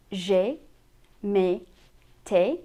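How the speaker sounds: j'ai, me, te with closed French "ay"; French é ending on j'ai, me, te; accent aigu vowel. Shown as a demonstration. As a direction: say j'ai, me, te one by one, rhyming with "ay". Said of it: "If we add the accent aigu".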